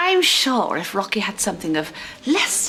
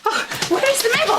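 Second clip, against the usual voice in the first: high, fast, panicked wail; frantic panic; 14 semitones higher, faster than usual. Acted. Searching for the entrusted medal, frantic. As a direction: wail it in a fluster.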